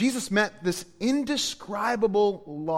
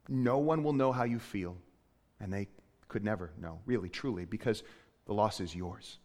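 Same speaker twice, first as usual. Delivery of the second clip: much softer, gentle comfort; tender and hushed; 8 semitones lower, 10 dB quieter than usual. Real, not acted. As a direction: speak softly as if to someone grieving. Tender comfort to grieving listeners.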